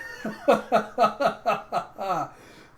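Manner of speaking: long, loud, high staccato cackle; ha-ha-ha of a delighted villain; booming villain laugh. Retold or imitated. Byron amused by the bomb threat, loud theatrical laugh.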